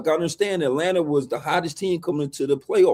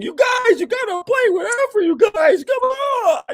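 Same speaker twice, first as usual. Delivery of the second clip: much higher, whiny mock plea; mock crying coach. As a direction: beg your players like a desperate coach. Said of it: "coaches on the sideline crying, saying".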